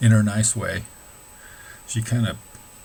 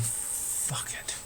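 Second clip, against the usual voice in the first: whispered give-up; 14 dB quieter than usual. Retold or imitated. Giving up, self-talk retold.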